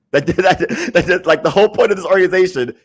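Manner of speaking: high and loud, laughing in exasperation; exasperated outburst. Real, not acted. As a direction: blurt it out in disbelieving outrage. Exasperated rant climax.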